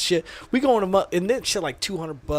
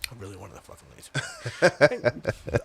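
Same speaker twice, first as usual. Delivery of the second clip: very quiet, low dejected mumble; mock-sulky, barely audible disappointment; down in the dumps; 8 semitones lower, 5 dB quieter than usual. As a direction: say it deflated, almost to yourself. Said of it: "I'm down in the dumps".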